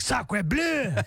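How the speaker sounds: mock French. Diners biting Big Macs, in mock French awe. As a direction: toss off a cartoon French "Sacre bleu". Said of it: "They're like".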